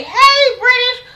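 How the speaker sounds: a sing-song friendly hi; mock high greeting to British; bright high greeting. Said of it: "she didn't say".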